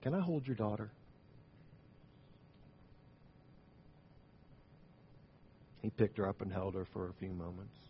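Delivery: hushed storytelling. Acted. Preacher softly telling the congregation the ending, hushed. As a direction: let the story fade to a hush.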